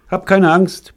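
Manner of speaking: plain reading voice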